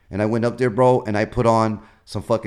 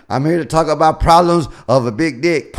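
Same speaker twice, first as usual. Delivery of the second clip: loud stage announcement; 5 semitones higher, 8 dB louder than usual. Retold or imitated. Drunk comic's stage opener.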